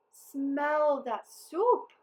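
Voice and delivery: slow, loud, high exclamation; exclaiming. A narrator's reading. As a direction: savour it aloud, drawn out. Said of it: "he exclaimed".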